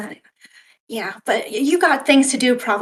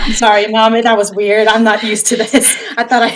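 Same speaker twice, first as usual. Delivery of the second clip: louder, giggling sorry; cracking up while apologising to Mom; laughing apology; 7 dB louder than usual. Real, not acted.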